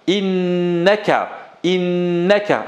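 innaka said slowly, in-na-ka with a held double n; patient teacher modelling innaka; slow repeat. Shown as a demonstration. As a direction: drill innaka slowly for learners to echo. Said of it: "Allez avec moi".

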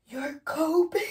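hammed-up stunned question; high, disbelieving squeak; mock astonishment. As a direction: act floored by the reveal. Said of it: "you're like".

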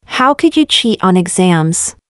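usual speaking voice

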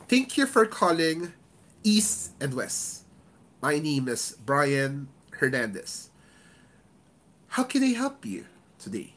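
slow greeting, weight on EAST and HOW CAN I HELP; deliberate agent greeting, key words drawn out; stressed key words. Shown as a demonstration. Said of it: "Where did I give stress?".

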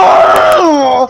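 high, drawn-out groan of defeat; anguished cry. Real, not acted. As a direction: wail as it goes wrong. Fails the jump after urging.

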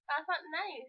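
normal talking voice

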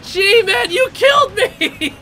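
high, loud pleading yells; exasperated shouting. Real, not acted. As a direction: beg G-Man to stop, frustrated. Teammate G-Man kills player.

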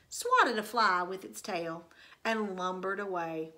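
usual speaking voice

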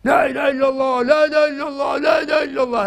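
high, loud chanted shout of the professor; imitating the professor yelling in class; imitated shouting. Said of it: "Bağırıp duru".

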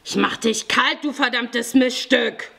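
enraged robber's menace; furious threat. A narrator's reading. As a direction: threaten her, voice pushed up in rage. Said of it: "Fjodor wurde wütend".

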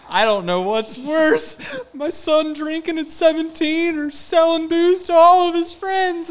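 high, slow, wailing imitation of his crying mom; imitating crying mom. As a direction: wail it up high and slow like a sobbing mother. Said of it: "And she really starts crying".

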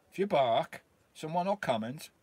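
ordinary delivery

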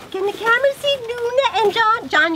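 high sing-song pet voice; talking to dogs. Real, not acted. Wife calls the dogs to the camera in pet voice. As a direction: coo it up high like to a puppy.

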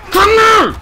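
high, loud shout at the screen; yelled call. Real, not acted. Gamer yelling at his player to come here. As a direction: bark it out to the player.

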